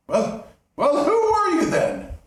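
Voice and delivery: high, loud frightened cry; terrified miser. Acted. Scrooge crying out in terror as the ghost enters.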